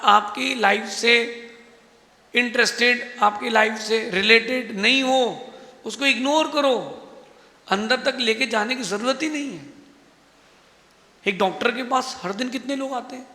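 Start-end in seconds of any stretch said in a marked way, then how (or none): none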